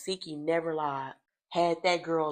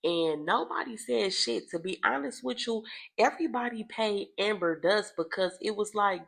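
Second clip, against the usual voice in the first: ordinary delivery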